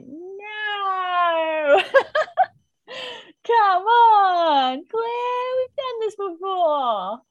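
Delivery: high, drawn-out cry of dismay; theatrical groaning protest at a character; exasperated wail. Retold or imitated. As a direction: wail it loudly, dragging out each word, a laugh breaking in. Acting out dismay at the plot.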